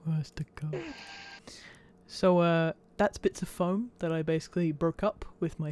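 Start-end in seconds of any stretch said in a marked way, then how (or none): 0.0-0.8 soft unvoiced echo; whispered echo. Real, not acted. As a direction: breathe the words back. Echoing the narrator.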